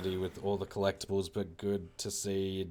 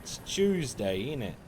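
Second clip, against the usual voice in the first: put-on British accent; 5 semitones higher, 5 dB louder than usual. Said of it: "I don't say my L's or T's".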